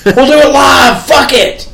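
high, loud shouted anchor rant; Bill O'Reilly freak out. Retold or imitated. Quoting the anchor's on-air meltdown, high and shouted. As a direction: yell it like a furious news anchor. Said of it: "the Bill O'Reilly freak out".

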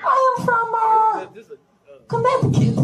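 put-on thick Russian accent, pitch jumping high; fake Russian accent. A Russian guy insisting he's from Connecticut in a heavy Russian accent. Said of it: "and I'd go".

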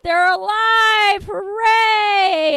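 high, loud, slow cheer; hammed-up joyful shouting; mock cheering. Friends cheering that the heroes survived, loud and high. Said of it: "everyone's like".

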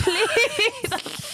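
high-pitched, wheedling plea; pleading. Real, not acted. Co-host begging for the accent to be dropped in, pleading. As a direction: beg for it, voice way up.

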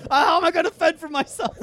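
high, loud, laughing wail; mock helplessness. Grown man playing left home alone, high mock-helpless wail. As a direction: whine it big like an abandoned kid.

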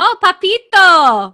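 slow, loud Spanish-accented purr; drawn-out Latin lover accent. Shown as a demonstration. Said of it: "There you go".

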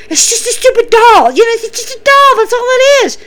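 high, frantic mock reassurance; panicked Shayna. Shayna fleeing falling shelves, high frantic self-reassurance. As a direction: squeak it out, trying to calm herself. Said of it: "She starts saying".

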